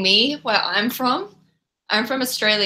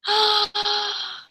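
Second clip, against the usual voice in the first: delighted gasp; 13 semitones higher than usual. Real, not acted. Another Australian joins chat.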